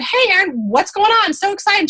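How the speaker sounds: high, bright put-on excitement; fake cheerful greeting. Sponsor greeting a recruit with forced cheer, high-pitched. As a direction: sing it out, overly cheery and high. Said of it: "reached out to her and said".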